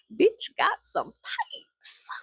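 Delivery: very high, raspy, strained and stretched out; drawn-out high rasp, playful; mock screech. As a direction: squeeze it into a slow mock screech. Repeats it in the screech voice.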